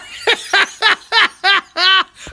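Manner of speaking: high delighted laugh; loud high giggle after the call. Real, not acted. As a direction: let out a big happy laugh. Laughs after calling wife.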